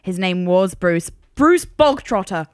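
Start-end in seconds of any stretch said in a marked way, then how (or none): 1.3-2.5 triumphant. Real, not acted. Triumphant answer.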